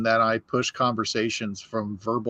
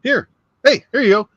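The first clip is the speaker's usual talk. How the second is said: higher and louder, a breezy mock boss voice; cheery boss; 11 semitones higher, 7 dB louder, faster than usual. Boss handing the anniversary pin over, high and chipper. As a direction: hand it over all chipper and oblivious. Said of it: "and he goes".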